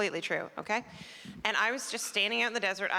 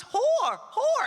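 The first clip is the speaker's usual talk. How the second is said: mock Japanese accent; 5 dB louder than usual. Princess pointing out a policeman's horse, loud mock accent. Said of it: "she points and she goes".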